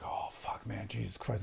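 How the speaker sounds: breathy whispered gasp; mock-aroused whisper. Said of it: "She goes like".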